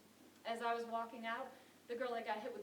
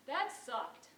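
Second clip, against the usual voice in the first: louder, higher verdict; blunt dismissal; 9 semitones higher, 3 dB louder than usual. Acted. Producer judging her audition, blunt dismissal. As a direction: deliver a blunt, damning verdict. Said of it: "And then he finally said".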